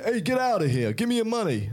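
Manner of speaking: high-pitched mugger with a hammed-up New York accent; playful New York tough-guy accent; mock New York accent. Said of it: "By the accent".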